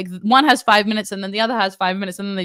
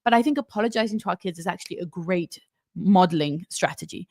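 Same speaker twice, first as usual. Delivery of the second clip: normal talking voice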